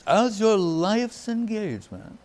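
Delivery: high, soft and sweet imitation of a gentle friend; softly, sweetly, gently. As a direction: coax it sweetly, like a kind proposal. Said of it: "he's very softly, sweetly, gently".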